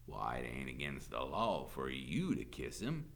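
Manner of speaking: low, lazy Southern drawl; drawling sheriff. A narrator's reading. Sheriff explaining the law to teacher, drawling. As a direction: explain it with smug ease. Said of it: "in a slow drawl".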